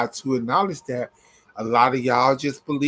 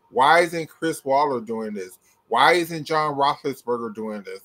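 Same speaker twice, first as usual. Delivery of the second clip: usual speaking voice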